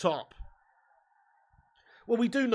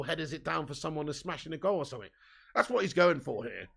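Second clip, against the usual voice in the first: normal talking voice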